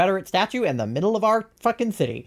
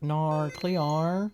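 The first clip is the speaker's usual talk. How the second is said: softer, drawn-out meme delivery with rising end; quieter meme voice; 5 dB quieter than usual. Acted. Streamer repeating a favorite meme, subdued drawn-out put-on voice. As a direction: stretch it out softly, lifting the end. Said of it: "I love doing that meme".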